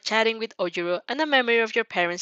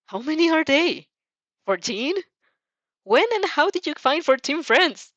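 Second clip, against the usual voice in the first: amazed; 7 semitones higher, 4 dB louder than usual. A narrator's reading. Narrator amazed at friend count.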